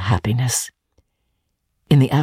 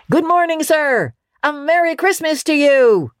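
cheery greeting; 12 semitones higher than usual. Acted. Cheerful passers-by greet Scrooge.

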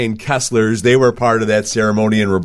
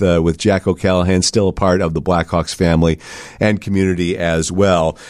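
normal talking voice

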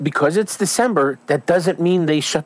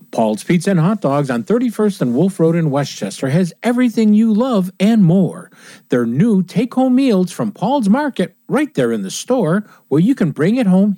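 lively, higher-pitched commercial voice; ad read; 6 semitones higher, 3 dB louder than usual. Acted. Radio host pitching take-home meals to listeners, ad read. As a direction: sell it like a radio spot.

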